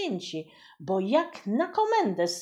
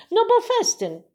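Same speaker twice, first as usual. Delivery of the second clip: put-on eager kitchen boy; 13 semitones higher, 9 dB louder than usual. Boy answers voivode anxiously.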